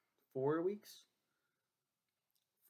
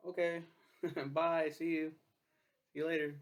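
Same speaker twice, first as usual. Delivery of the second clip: mocking goodbye; 4 semitones higher, 6 dB louder than usual. Mock farewell to coworkers.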